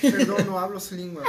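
loud laughing joke line; laughing mock foreigner. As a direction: laugh through a fake language mixup. Jokes about jet-lag language mix.

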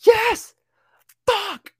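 very high, loud yes then fuck; thrilled outburst. Retold or imitated. Fan reacting in the theater, high thrilled outburst. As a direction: burst out in delight. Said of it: "I was like".

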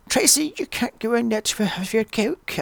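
high-pitched mock accent, badly done; a poor, exaggerated imitation of a film accent; John Travolta. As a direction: put on a high, hammy attempt at the accent. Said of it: "I can't do the accent".

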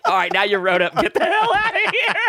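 coach's order told through laughter; laughing retelling. Retold or imitated. Coach throwing out the student, retold through helpless laughter. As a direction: imitate the coach, breaking up laughing, pitch climbing.

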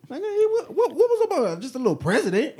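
high laughing disbelief. Real, not acted. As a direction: laugh it out incredulously. Mock downplaying Obama, laughing.

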